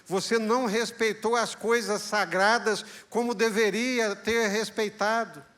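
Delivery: father's refusal. Acted. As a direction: tell your son it is over, gravely. (o pai negando a bênção ao filho, firme e triste)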